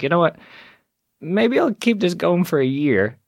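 carried away. Retold or imitated. Carried away after a successful show.

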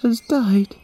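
put-on a little girl heartbroken over her brother; Yami grieving. A grieving girl answering the animals, high and sad. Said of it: "Yami told them".

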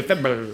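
February collapsing into Feb-bluh-luh; losing-it mumble. Shown as a demonstration. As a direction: start February, then babble the rest. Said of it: "then I just lose my mind".

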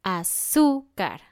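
"Azúcar" slow, long strong ZÚ; penultimate stress. Shown as a demonstration. Teacher adding an example, middle syllable stressed in "Azúcar". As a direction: draw out "Azúcar" as a-ZÚ-car. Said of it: "más fuerte en la penúltima sílaba".

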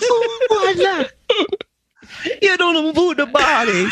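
put-on high, laughing Italian accent. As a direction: keep up the mock Italian accent. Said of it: "I'm doing an accurate Italian accent".

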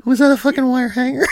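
quick, high mock killer voice; strangely high-pitched voice. Joking as the stabbed killer, strangely high-pitched. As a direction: put on a fast falsetto. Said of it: "in a quiet, strangely high-pitched voice".

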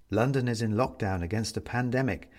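usual speaking voice

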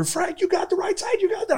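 high-pitched reenacted callout; acting it out like a hyped-up cop on a raid; retold cop callout. Reenacting his old cop video, he replays a partner's high callout. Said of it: "we come rolling in another cruiser".

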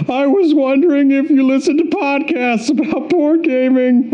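high-pitched goofy falsetto; absurdly high put-on voice; silly character voice. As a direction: squeak it way up high as a joke voice. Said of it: "You gotta give Jay a voice".

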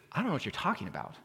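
fast, soft, baffled reply; baffled thief. Acted. Thief baffled by the supervisor angel. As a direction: shrug off a question he can't follow. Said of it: "And he says".

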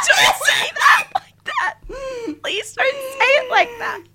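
high squeal through helpless laughter; retold horror, squeaking with laughter; laughing plea.

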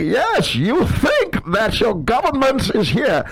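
high, loud, slow villain impression; grand, drawn-out mock menace; Bane. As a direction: stretch the words out like a theatrical masked villain. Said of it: "And then we do Bane".

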